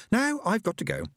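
put-on high hurried young woman; sister's voice. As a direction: sister rushing off. Sister leaving.

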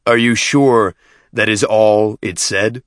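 slow, loud, doubting question; suspicious questioning. A narrator's reading. Chieftain doubting the deacon's translation, suspicious. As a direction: press him slowly, rising at the end.

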